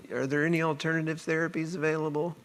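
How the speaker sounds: quiet, sheepish plea to the doctor. Retold or imitated. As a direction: ask meekly, afraid of the answer.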